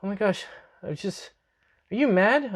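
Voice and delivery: high-pitched imitation of an anxious girlfriend; worried girlfriend. Girlfriend voiced in a retold phone call.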